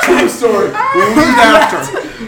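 slow, laughing repeat. Real, not acted. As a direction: stretch it out through laughter.

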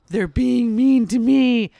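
put-on slow, drawn-out little-kid wail; mock crying child. Five-year-old tattling to mom on older brothers, imitated tearful whine. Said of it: "hysterical crying".

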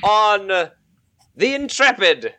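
high proclamation of reward; announcer voice. Acted. Game master awards experience.

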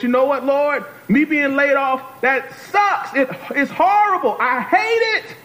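high, exasperated complaint; venting to God. Acted. Preacher models honest prayer about a layoff, exasperated. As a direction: pour out frustration, pitched high.

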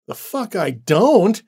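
high, loud, slow defiant cry; defiant shout. Acted. Annie refusing Randall's warning, slow defiant shout. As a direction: spit it out in defiance.

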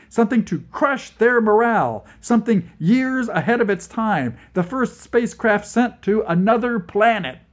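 loud, high urgent shouting; shouting over wind. A narrator's reading. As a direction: shout over the car's noise. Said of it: "Needlin shouted at us".

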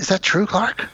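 a hint of wide-eyed Eddie; gullible Eddie. Host quoting Eddie asking Clark, mild wonder. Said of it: "He says".

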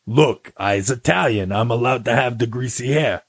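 hammed-up Brooklyn Italian accent, defensive; Ernie. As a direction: play a Brooklyn Italian tech guy. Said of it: "now you have one".

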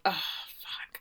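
breathless whispered oh fuck; whispered curse. Real, not acted. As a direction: whisper it in dismay. Her reaction to the engagement.

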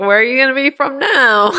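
hammed-up disbelief at the lie; mock incredulous question. Poking fun at Steve's lying, loud mock disbelief. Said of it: "and you're like".

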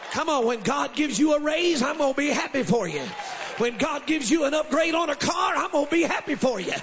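high, loud shouted refrain that keeps climbing; preaching at full force. Acted. Preacher to congregation, building a shouted refrain of joy.

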